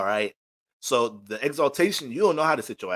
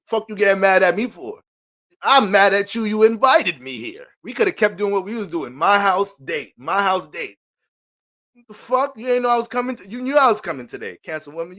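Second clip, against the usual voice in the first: loud, higher, indignant yelling; angry outburst; 6 semitones higher, 6 dB louder than usual. Acted. A date yells at the Cancer woman who got mad, furious. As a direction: blow up at her for turning on you.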